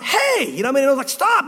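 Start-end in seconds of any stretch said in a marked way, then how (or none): 0.0-0.5 sharp call out. Retold or imitated. Preacher calling out to spitting kids, sharp and high. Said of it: "I kinda went". 1.1-1.5 sharp high "Stop" called out; loud stop. Retold or imitated. Preacher telling spitting kids to stop, sharp high call. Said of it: "I said it kinda loud".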